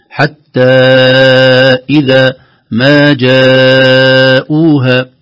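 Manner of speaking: "إذا ما جاءوها" chanted very slowly, the long vowels held; drawn-out madd. Shown as a demonstration. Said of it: "المد الأول مد منفصل". (مد طويل في "إذا ما جاءوها")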